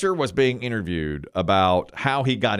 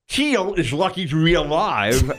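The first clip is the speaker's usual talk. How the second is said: talking around a pencil held sideways; pen in teeth; 6 semitones higher, slower than usual. Shown as a demonstration. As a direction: diction drill with a pencil in mouth. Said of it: "you put it in the side of your mouth".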